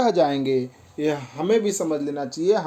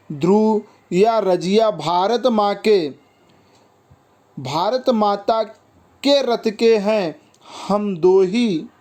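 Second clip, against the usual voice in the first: slow, higher-pitched verse delivery; poem recitation; 4 semitones higher, slower than usual. Acted. Boys and girls making peace in the verse, poem recitation. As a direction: declaim the stanza line by line, drawing out the words.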